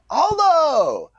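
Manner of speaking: high, loud drawn-out call of a name. Retold or imitated. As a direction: holler the name, high and long. Calling out to Aldo.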